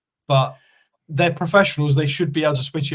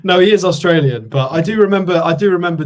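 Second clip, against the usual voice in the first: laughing and louder, conceding; 5 semitones higher, 7 dB louder than usual. Real, not acted. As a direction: talk through a laugh. Laughing after teasing.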